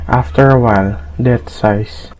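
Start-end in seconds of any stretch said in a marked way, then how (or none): none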